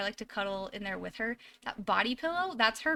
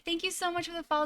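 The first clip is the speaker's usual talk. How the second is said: ordinary talk, nothing marked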